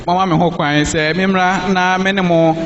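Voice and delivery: ordinary talk, nothing marked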